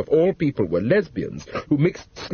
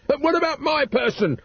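yelled; 13 semitones higher than usual. A narrator's reading. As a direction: protest in a high squawk. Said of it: "yelled Mister Racine".

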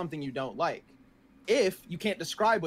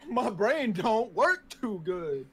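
mock dumb voice; 4 semitones higher, 3 dB quieter, much slower than usual. Mocking imitation, slow.

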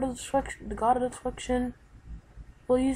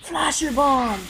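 attack shout; 6 semitones higher, 7 dB louder, slower than usual. Acted. Firing a special attack.

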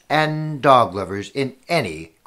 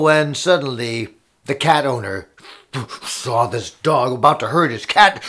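ordinary delivery

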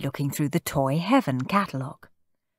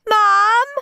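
high, loud yell for Mum; piercing child's call; shrieked call; 6 dB louder than usual. A narrator's reading. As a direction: squeal for mother's help. Shrieking boy calls for mother.

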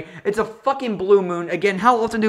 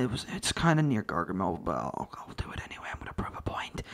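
mock whisper; 10 semitones lower, 13 dB quieter than usual. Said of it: "Clumsy's like".

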